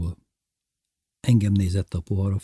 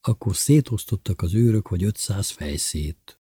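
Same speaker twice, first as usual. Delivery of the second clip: ordinary delivery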